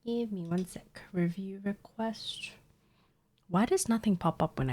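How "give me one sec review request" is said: whispered aside to herself; whispering. Real, not acted. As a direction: breathe the words, no voice.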